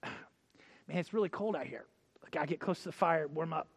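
low, soft changing of subject; acted muted, dodging small talk; evasive aside. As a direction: mumble an excuse to slip out of it. Peter dodging the accusers by fire.

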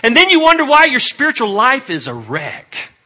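loud, high rebuke; full-force preaching. Real, not acted. Preacher rebuking congregation over spiritual neglect, loud and high.

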